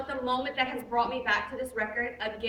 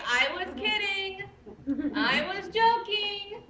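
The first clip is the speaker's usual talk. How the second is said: mock excuse; 5 semitones higher, slower than usual. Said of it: "So you can't just say".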